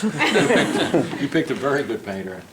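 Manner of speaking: laughing through a mock plea; high laughing start that settles down. Painter mimicking the actor, laughing through it.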